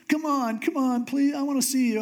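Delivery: put-on high-pitched childlike wheedling; whiny little kid. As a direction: nag like a little kid begging for a treat. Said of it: "I'm like a little kid".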